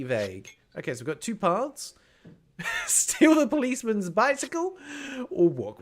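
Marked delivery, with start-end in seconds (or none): 2.6-5.8 high, loud, laughing delivery. Real, not acted. Player amused by bicycle option, laughing. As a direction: read it out through a laugh.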